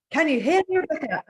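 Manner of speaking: live reporter checking the connection; reporter on a bad line. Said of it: "I feel like I'm a reporter now".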